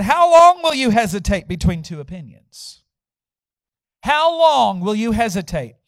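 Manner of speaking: loud, ringing declamation; preaching scripture. Acted. Elijah to all the people, forceful preached reading. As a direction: drive the challenge home to the congregation.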